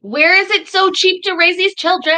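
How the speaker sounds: loud, high-pitched cry of disbelief; outraged shout. Real, not acted. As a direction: shout it, appalled at the amount. Outraged reaction to low child support.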